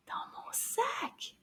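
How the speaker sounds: slow, very soft whisper. Pretend. Madame wondering what is in her bag, whispering. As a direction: whisper it as a mystery.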